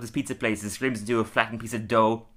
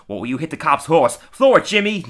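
excited, raised-voice egging on; playful shout to a kid to throw; imitating Moe; 4 semitones higher, 6 dB louder than usual. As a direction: call out higher and louder, mock rowdy. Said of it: "Mo goes".

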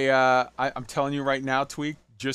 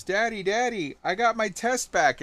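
little daughter voice; 7 semitones higher than usual. Retold or imitated. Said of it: "your eight-year-old daughter runs up to you and goes".

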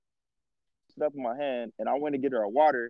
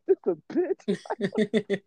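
exasperated drawl; 5 semitones higher, much slower than usual. Retold or imitated. Slow, drawn-out exasperated quote.

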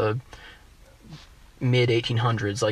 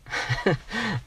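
a quick high-pitched laugh that falls away; short high laugh; 9 semitones higher, 4 dB quieter than usual. Real, not acted. Guest laughing at losing the point, short high laugh. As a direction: laugh up high, then let it drop. Laughs mid-argument, loses thought.

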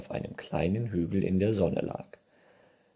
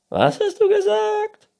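very high, loud squeak; shouting squeaky raccoon; hard-of-hearing raccoon; 11 dB louder than usual. A narrator's reading. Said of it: "Du musst lauter reden". (schwerhöriger Waschbär, hoch und laut)

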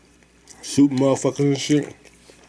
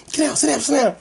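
mock impatient order, voice pitched up; impatient bus driver; 10 semitones higher than usual. Bus driver hurrying a passenger, impatient. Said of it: "bus driver was so impatient".

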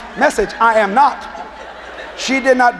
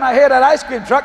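plain reading voice

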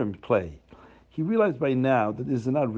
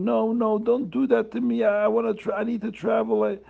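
high, wailing plea to be let go; begging through tears, lightly acted; poor man pleading with Reb Chaim not to jail him; crying plea; 6 semitones higher than usual. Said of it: "The poor man starts crying".

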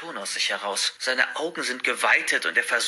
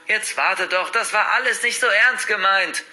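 raised, high, pleading call; calling after; 8 semitones higher, 4 dB louder than usual. A narrator's reading. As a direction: call after a friend walking off. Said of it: "ruft er mir hinterher". (hinterherrufend, beschwichtigend)

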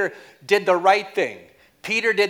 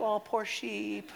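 mock pity; 3 semitones higher, 9 dB quieter than usual. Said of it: "You're like".

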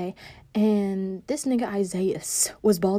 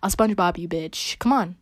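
ordinary talk, nothing marked

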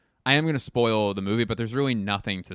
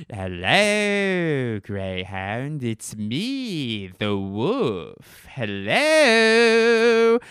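drawn-out swooping taunt; wolf impression; 4 semitones higher, 5 dB louder, much slower than usual. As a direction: stretch words into a wolf's howl. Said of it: "here's my impression of".

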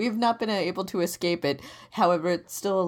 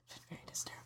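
hushed whisper; 21 dB quieter, much faster than usual. Real, not acted. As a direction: whisper it as an aside. Whispered aside.

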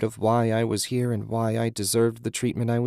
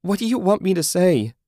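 high, loud exasperated yell; 10 semitones higher, 6 dB louder than usual. A narrator's reading. Julie yelling back at Susan, loud and high. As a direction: yell the question back in desperation. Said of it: "Julie yelled".

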